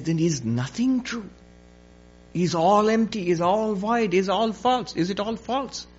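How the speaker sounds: acted dismay, pitch climbing; horrified. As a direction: plead as the horror dawns. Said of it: "He's horrified at this".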